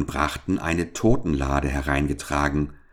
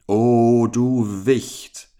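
put-on higher, louder, very slow menacing old man; old giant's cry; 5 semitones higher, 5 dB louder, much slower than usual. A narrator's reading. Old bearded giant threatens the boy, slow menacing cry. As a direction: drag it out like a looming old giant. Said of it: "rief er".

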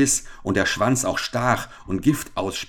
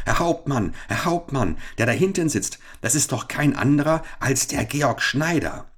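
higher, excited alarm; urgent calling out; 7 semitones higher than usual. Acted. Soldier alerting his captain to the stowaway, calling out. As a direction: call out a sudden discovery. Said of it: "sprang auf ihn zu und rief".

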